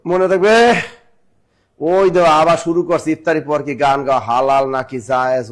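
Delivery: usual speaking voice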